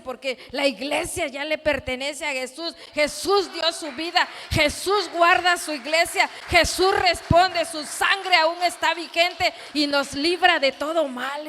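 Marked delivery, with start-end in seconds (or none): 2.9-11.5 loud, raised declamation building to a shout; fervent, ringing sermon delivery; full-force preaching. Real, not acted. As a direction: proclaim it to the congregation, punching each phrase.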